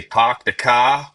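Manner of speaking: slow, drawn-out New England "Park the car" with the r's dropped; playful New England accent test. Shown as a demonstration. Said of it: "that New England accent there too".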